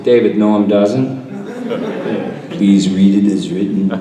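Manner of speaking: laughing plea. Retold or imitated. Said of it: "I went to David Cohen".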